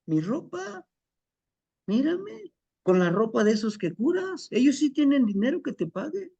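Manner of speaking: put-on high pitched, aggrieved young man; complaining son. Son complains to his father about his clothes, high and aggrieved. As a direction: whine about poverty to the father. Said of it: "son complaining about his clothes".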